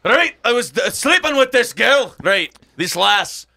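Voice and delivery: loud, high, hammed-up Scottish brogue; a deliberately bad, shouted Scottish voice; shitty Scottish accent. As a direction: bellow it like a rowdy Scotsman in a pub. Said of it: "but I can do shitty Scottish".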